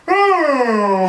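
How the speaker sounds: a high, loud "Oh!" with the mouth wide open; the frog reacting to Missus Robin, wide-mouthed "Oh!". A narrator's reading. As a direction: yawn the "Oh!" open as wide as you can. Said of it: "that's the way they talked".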